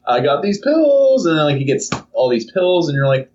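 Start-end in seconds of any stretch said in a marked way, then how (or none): 0.0-1.2 high, slow, drawn-out pitch; sly dealer offer. Acted. Someone offering pills to Joseph, slow sly offer. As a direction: dangle the offer slowly. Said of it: "Someone's like".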